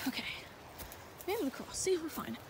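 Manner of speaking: ordinary delivery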